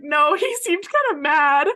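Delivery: retelling the friend's line, laughing, slowly; laughing quote. Retold or imitated. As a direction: laugh through it. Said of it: "And she was like".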